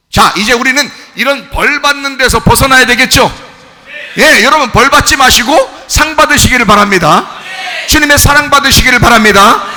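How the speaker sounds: high, loud, exhorting pulpit voice; full-force preaching. Real, not acted. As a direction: proclaim it to the whole congregation.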